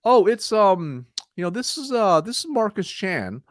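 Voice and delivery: high, halting and hesitant; unsure caller with questioning lifts; weak opener. Shown as a demonstration. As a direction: stumble through it like you don't belong. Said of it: "Like, they sound weak".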